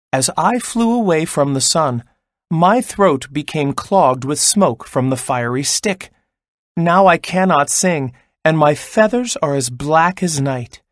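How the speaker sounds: usual speaking voice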